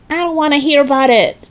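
put-on high, fast protesting boy; boy crying out. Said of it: "cried George".

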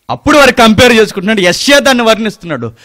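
high, loud, fast preaching; fiery preaching. Acted. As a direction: drive the point home to the congregation. Preaching at full force. (ఉద్రేకంగా బిగ్గరగా ప్రసంగం)

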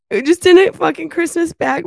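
ordinary delivery

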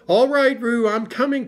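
high, urgent call. A narrator's reading. Christopher Robin runs to rescue Roo, calling out. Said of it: "called Christopher Robin".

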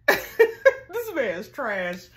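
laughing it out loud; cracking up while calling him trash; loud amused laugh through the words. Real, not acted. Laughing reaction to Greg's story.

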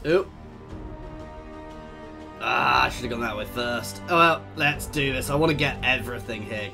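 usual speaking voice

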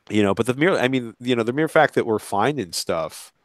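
ordinary talk

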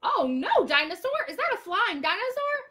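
high, loud gasping surprise; mock alarm. Teacher acting shocked for the class, high and loud. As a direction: act shocked for the kids.

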